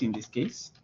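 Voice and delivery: normal talking voice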